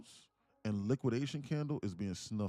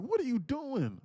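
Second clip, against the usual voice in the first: much higher, louder girlfriend imitation; startled girlfriend; 8 dB louder, slower than usual. Girlfriend pushed through the door, high startled question. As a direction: play her surprised at being pushed.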